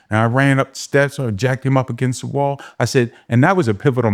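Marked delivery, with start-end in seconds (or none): none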